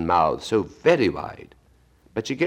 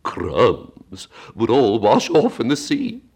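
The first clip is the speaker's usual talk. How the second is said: slow Mock Turtle voice; 6 semitones higher, 6 dB louder, much slower than usual.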